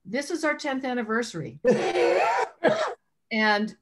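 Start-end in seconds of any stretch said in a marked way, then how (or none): 1.6-2.9 loud, high-pitched burst of laughter; excited laugh. Real, not acted. Host announcing the store's tenth anniversary, loud high laugh.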